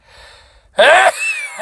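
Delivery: loud explosive sneeze; big real sneeze, full force; sneezing. Real, not acted. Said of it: "is try to sneeze".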